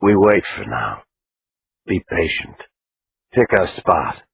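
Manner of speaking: low and level, like a cop laying out a plan; hushed male voice planning in church; Conlon's voice. Acted.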